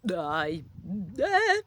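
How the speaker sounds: halting, dim-witted stammer; stupid stammer. A narrator's reading. Flustered kid answering stern man, stupid stammer. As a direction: get stuck on the first word. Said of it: "you stammer stupidly".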